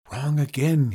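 ordinary delivery, nothing marked

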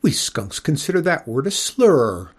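a lightly voiced lady skunk, haughty and hurt; offended prim lady. Miss Mephitis rebuking the boy for a slur, haughty lady voice. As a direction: correct him with wounded dignity.

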